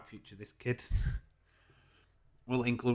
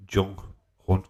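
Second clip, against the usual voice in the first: ordinary talk, nothing marked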